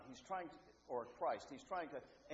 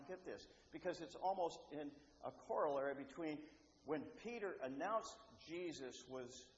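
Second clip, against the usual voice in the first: ordinary delivery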